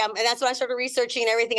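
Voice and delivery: ordinary talk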